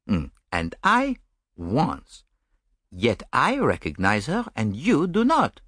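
amused, precise Belgian detective; gently mocking his romantic friend; Poirot.

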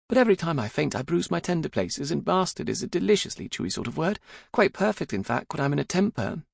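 high, pert daughter voice; high-pitched, unrepentant young woman; spirited young daughter. A narrator's reading. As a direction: argue cheekily with Papa, pitched well up.

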